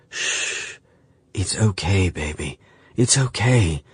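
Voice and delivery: soft hush, gentle comfort; calm her softly; soothing. Acted. Landon soothes Claire.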